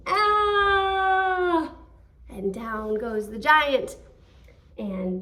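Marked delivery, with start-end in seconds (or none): 0.1-1.8 loud scream; giant's falling scream. Acted. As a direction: yell as the giant falls. Giant falls.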